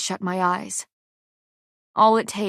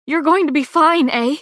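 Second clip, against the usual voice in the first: higher, louder tearful pleading; desperate reassurance; 7 semitones higher, 7 dB louder than usual. A narrator's reading. Pei pleads over her dying friend. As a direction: beg her to be fine.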